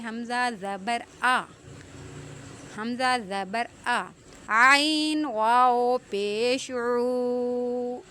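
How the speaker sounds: حمزہ زبر آ spelled slowly, عین واو پیش عو drawn out; spelling out sounds. Shown as a demonstration. Teacher breaking a word into sounds, slow. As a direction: spell each letter with its vowel, stretching عو. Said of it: "کیسے پڑھیں گے؟".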